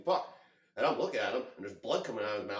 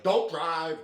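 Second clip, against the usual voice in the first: urgent warning; 4 semitones higher, 7 dB louder than usual. Retold or imitated. Bystanders urgently stopping him driving.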